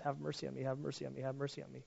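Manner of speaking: whispered, fading plea; begging for my life. Acted. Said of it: "like you before God".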